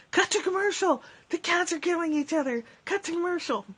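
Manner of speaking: high, hammed-up cry to cut to commercial; mock panic. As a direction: play a frantic host losing control. Cats fighting, mock broadcast panic.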